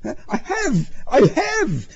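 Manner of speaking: high, flustered insistence; high panicky protest; anxious cry. A narrator's reading. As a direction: plead it hurriedly to the cop. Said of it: "Pruskin cried, hurriedly searching".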